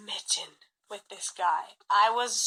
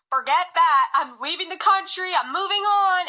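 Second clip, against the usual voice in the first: higher, louder, done-with-it declaration; defiant declaration; 7 semitones higher, 6 dB louder than usual. Retold or imitated. Retelling her vow to leave, defiant and higher. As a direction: throw it off and announce you're leaving. Defiant resolve before leaving country.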